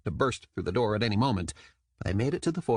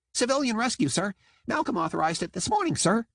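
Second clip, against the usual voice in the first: squeaky dolphin soldier; 11 semitones higher than usual. A narrator's reading. Jam dolphin voice.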